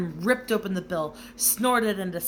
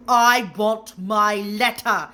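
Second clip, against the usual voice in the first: louder, slow, each word pressed; shouting; 2 semitones higher, 10 dB louder, much slower than usual. A narrator's reading. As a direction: stand your ground. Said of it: "he shouted".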